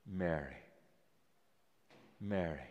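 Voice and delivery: tender naming. A narrator's reading. The risen Jesus tenderly calling Mary by name. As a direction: say her name gently, like a revelation.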